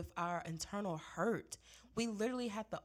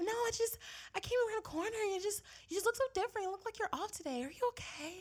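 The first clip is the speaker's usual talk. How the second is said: much higher, fast fussing colleague; nosy coworker; 10 semitones higher, faster than usual. Retold or imitated. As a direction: rattle it off like a worried busybody. Coworker explains her concern.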